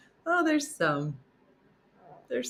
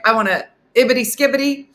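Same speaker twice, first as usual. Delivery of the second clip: grandpa ordering; 7 semitones higher, 8 dB louder, faster than usual. Retold or imitated. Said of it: "He was like".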